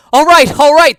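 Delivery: very high, loud surrendering shout; yelling. Acted. Cornered thief yells his surrender to the cop, very high and loud. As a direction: yell it, giving up fast. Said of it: "the man yelled".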